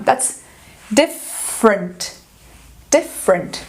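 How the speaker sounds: "different" low and very slow, diff... frent; schwa bridge. Shown as a demonstration. Teacher correcting for learners, slow two-beat "different". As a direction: stretch "different" into two beats without the middle E. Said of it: "essa palavra é escrita assim".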